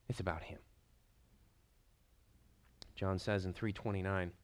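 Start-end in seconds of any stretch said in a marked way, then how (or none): 0.1-0.6 hushed whisper. Acted. Preacher whispering the quote to the congregation. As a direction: whisper it, barely voiced.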